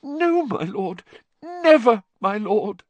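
high, eager fearful vow; frantic pledge. A narrator's reading. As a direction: rush to swear loyalty. Wormtail swears loyalty.

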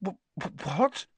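startled stutter, rising high; shocked stammer. A narrator's reading. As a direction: trip over the first word in shock. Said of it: "Harry stammered".

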